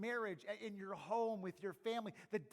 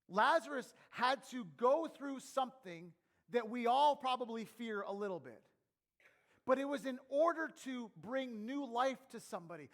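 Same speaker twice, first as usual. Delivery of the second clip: louder, emphatic sermon delivery; raised preacher's voice, firmly punched; forceful preaching; 4 semitones higher, 7 dB louder than usual. Real, not acted. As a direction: drive the point home to the whole room.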